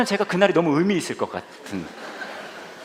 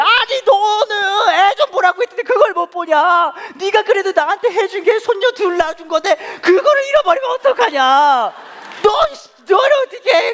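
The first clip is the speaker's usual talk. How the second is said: wailing, high-pitched loud rant; hammed-up imitation of mom screaming in fury; angry mother; 7 dB louder than usual. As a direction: berate your son in drawn-out hysterics. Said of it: "화를 내고 또 계속 불러".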